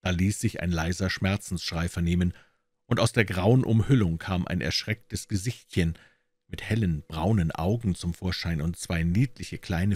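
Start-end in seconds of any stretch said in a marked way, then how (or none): none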